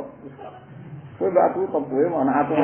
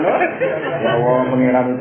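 drawn-out sigh; 5 dB louder, much slower than usual. Real, not acted. Exasperated exclamation to God.

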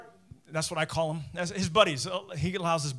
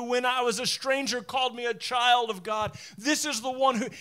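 fervent preaching; 7 semitones higher, 7 dB louder than usual. Acted. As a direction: proclaim it like a delighted worshipper. Preaching the worshipper's joy.

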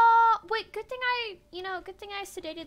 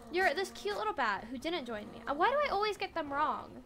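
ordinary talk

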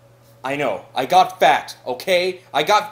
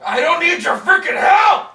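high, loud burst of temper; angry yell; 9 semitones higher, 8 dB louder than usual. Acted. Dan refusing his friend's help, angry yell. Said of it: "I'm sorry I yelled at you".

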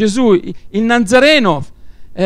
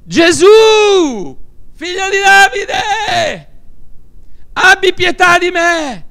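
high, drawn-out desperate shout; crying out; 11 semitones higher than usual. Acted. Blind man calling to Jesus over the crowd, crying out. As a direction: call out for mercy, stretching each phrase. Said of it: "lui gridava".

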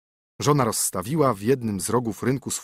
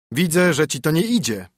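wyżej i głośniej, pouczający mąż; surowy mąż; 5 semitones higher, 5 dB louder than usual. A narrator's reading. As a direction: rozkazuj żonie jak zmęczony gospodarz. Husband rebukes her. (mąż pouczający żonę, głośniej i surowo)

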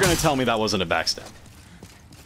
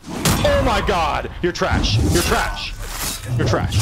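high, loud gloating shout; trash-talk yelling; 4 semitones higher, 4 dB louder than usual. Real, not acted. Gamer taunting the opponent as trash, loud gloating yell. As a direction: yell it in repeated bursts of scorn.

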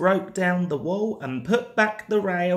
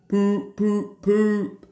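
hooted poops, then a slow gloating jibe; toot, then drag out the taunt; Gordon's engine taunt; 3 dB louder, slower than usual. A narrator's reading. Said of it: "Gordon would say".

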